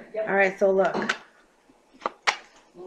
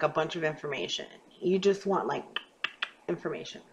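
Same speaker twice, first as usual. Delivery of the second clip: normal talking voice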